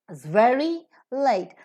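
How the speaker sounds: "very" and "late" drawn out, "Is" barely said; stress on very, late. Shown as a demonstration. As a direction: swallow "Is", land slowly on "very" and "late". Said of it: "only very and late are stressed".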